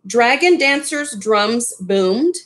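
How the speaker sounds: usual speaking voice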